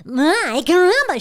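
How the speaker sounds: youthful cartoon boy catchphrase; early Bart voice. Showing how the original Bart once sounded, youthful boy. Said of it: "when Nancy Cartwright started".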